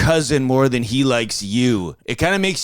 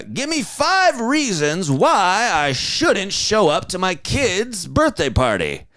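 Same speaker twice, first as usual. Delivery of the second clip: whiny divorced dad; 3 semitones higher than usual. Pretend. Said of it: "morning radio guy talking about his divorce".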